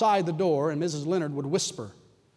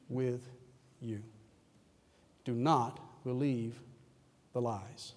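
tender; 5 semitones lower, 8 dB quieter than usual. Acted. God's whisper voiced in sermon.

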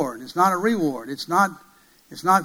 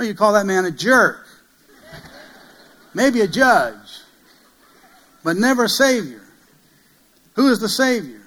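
raised, forceful preacher voice; higher, louder sermon delivery with weighty pauses; emphatic preaching; 5 semitones higher, 6 dB louder than usual. Real, not acted. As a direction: preach it out in punched phrases with falling ends.

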